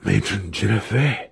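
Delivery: hushed-style excited exclamation; excited whisper. A narrator's reading. Wizard sees his summons working, excited stage whisper. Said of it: "heard Alton's excited whisper".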